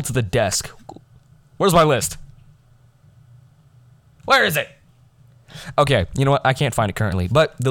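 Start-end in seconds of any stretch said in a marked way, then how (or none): 1.6-4.7 higher, rising panic; hunting for something lost; where did it go; frantic searching. Real, not acted.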